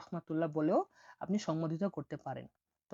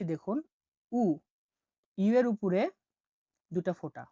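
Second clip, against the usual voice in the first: usual speaking voice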